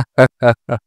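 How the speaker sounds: short, loud mocking laugh. Real, not acted. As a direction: laugh off the doubters. Mocking those who still doubt.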